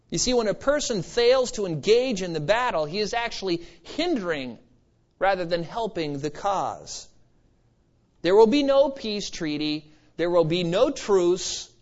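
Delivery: ordinary talk, nothing marked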